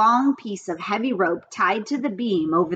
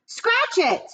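very high yell; 12 semitones higher than usual. A narrator's reading. Avery yelling back at his sister, very high. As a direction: fling it back while swinging. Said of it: "yelled Avery".

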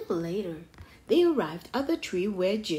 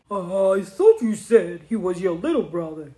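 loud, slightly lowered ape character; puzzled storybook ape voice; Norman the ape; 3 semitones lower, 9 dB louder than usual. A narrator's reading.

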